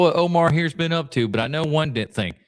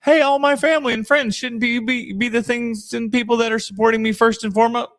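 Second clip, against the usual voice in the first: higher, put-on needy voice; mock whiny plea; 8 semitones higher than usual. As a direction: parody yourself begging family for support. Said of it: "you would be like".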